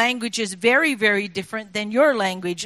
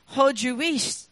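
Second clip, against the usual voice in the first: broad Scots hush, hissing the wheesht; Scottish accent; 3 semitones higher than usual. Shown as a demonstration. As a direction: say it like a Scottish granny. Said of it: "when they say".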